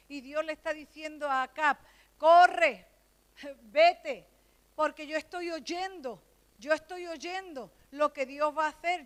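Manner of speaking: loud, driving sermon voice; preaching at full force. Real, not acted. Preacher telling of Elijah and the rain, loud preaching. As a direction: call it out to the church.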